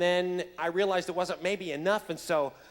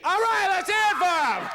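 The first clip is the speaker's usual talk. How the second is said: high, loud rallying call; shouting for applause; 12 semitones higher, 8 dB louder than usual. Real, not acted. Host to crowd after the song, shouting for applause. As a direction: whip up the crowd at full voice.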